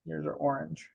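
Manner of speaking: ordinary talk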